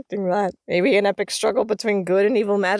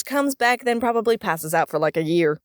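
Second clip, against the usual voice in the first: ordinary talk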